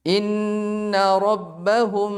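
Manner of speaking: slow chanted tajwid recitation; slow Quran recitation. Shown as a demonstration. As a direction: recite the verse slowly for the class. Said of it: "Coba antum tebak ini".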